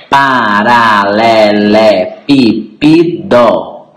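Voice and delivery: "Paralelepípedo" slowly drawn out, every syllable sounded apart. Shown as a demonstration. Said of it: "são seis sílabas". ("Paralelepípedo" pausado, sílaba por sílaba)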